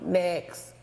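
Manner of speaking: ordinary delivery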